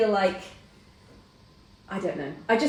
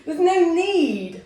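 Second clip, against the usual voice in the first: high, loud indignant outburst; retold outraged protest; 9 semitones higher, 6 dB louder than usual. Mother re-living shouting at the nurse who shaved her, high and loud. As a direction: fire it back at the nurse, appalled. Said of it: "And I was like".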